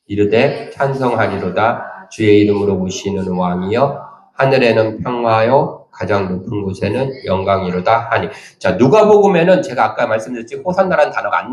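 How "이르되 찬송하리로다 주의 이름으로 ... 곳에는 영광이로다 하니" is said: slow, measured scripture reading aloud together; congregational reading. Real, not acted. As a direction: read the verse with the congregation. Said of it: "같이 읽겠습니다. 시작".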